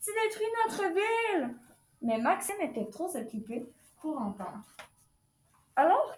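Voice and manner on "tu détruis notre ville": put-on high-pitched, childlike storybook protest; protesting cry. A narrator's reading. Jimmy to Maxime wrecking the toy city, high protesting cry. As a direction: cry out in a high voice, without real shouting. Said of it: "cria Jimmy".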